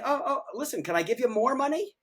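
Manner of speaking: high English phone voice; eager caller in an English accent. Shown as a demonstration. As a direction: put on an English accent, pitched well up. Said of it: "I'm gonna just use the English accent".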